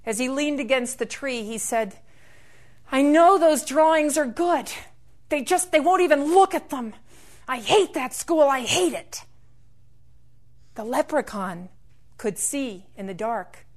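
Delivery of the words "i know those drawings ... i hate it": mildly acted bitter outburst, higher pitched; upset boy venting, a touch loud. Lame boy alone against the tree, bitterly upset. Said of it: "he was bitter sick of it".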